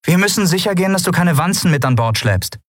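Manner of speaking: a hint of a strained woman giving orders; Andriel's voice. Andriel tells Lien to submit to a search, lightly voiced.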